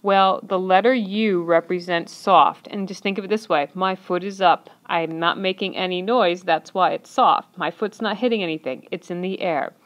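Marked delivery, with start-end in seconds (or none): none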